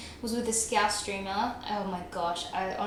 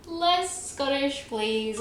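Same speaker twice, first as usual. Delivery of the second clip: slow, high "Less" stretched; stress on LESS; 5 semitones higher, much slower than usual. Real, not acted. Streamer begging for less Scottish, stretched LESS. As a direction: lean on the word "Less". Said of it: "repeats chat request".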